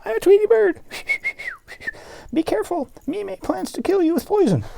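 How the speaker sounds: tiny high bird voice; very high cartoon bird; Tweety bird voice. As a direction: chirp it like a little bird. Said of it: "the Tweety bird comes by".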